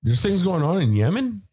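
hammed-up astonishment, pitch leaping high; mock surprise. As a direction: play dumb, overly shocked at the news. Feigned shock at old news.

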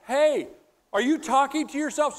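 wife calling to her husband outside. Retold or imitated. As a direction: call out to him across the yard. Wife calls out window.

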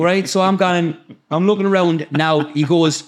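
usual speaking voice

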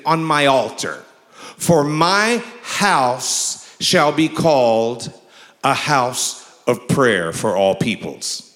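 slow, solemn pulpit reading; preacher intoning the verse; scripture reading. Acted. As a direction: read it with weight, drawing out the words.

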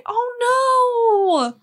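high wail of dismay. Acted. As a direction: gasp it out, heartbroken. Dismay at the withered trees.

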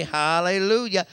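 normal talking voice

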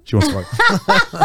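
high, slow, hammed-up mock of a deluded guy; mocking horny guy. Shown as a demonstration. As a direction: stretch it out high like a guy sure she wants him. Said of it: "I'm exaggerating".

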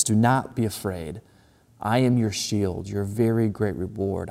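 slow, soft reading of the verse; hushed scripture reading. Acted. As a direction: read it gently, letting it land. Reading scripture slowly, softly.

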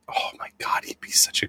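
breathless excited whisper; hushed, thrilled whisper about a casting dream. Real, not acted. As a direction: whisper it like a delicious secret.

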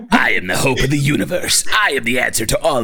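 lightly voiced heroic Goku, a bit strained; a hint of Goku declaiming; mock heroic Goku speech. Shown as a demonstration. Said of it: "that's more like imperfect".

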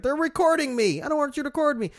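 high, loud mock alarm; mock paranoia. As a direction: panic in a hammed-up high voice. Voices paranoid Xbox users.

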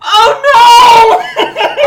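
high, loud mock-anguished cry; high-pitched theatrical "oh no" howl; distressed wail. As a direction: wail it out in hammed-up dismay. Player wails at dying master news.

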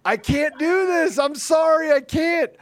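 high, slow, strained "I can't" voice; mock overwhelmed pleading. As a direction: act swamped and begging off. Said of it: "I'm thinking to myself".

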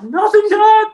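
put-on a frightened ten-year-old answering his dad; pre-puberty boy voice. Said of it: "Pre-puberty. Voice accurate".

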